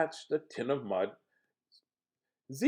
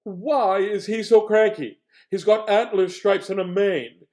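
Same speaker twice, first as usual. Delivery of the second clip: loud, higher lion voice; puzzled lion complains loudly; lion's voice; 5 semitones higher, 10 dB louder than usual. Acted. Lion asks the others why Bear is cranky, loud. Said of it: "Lion said".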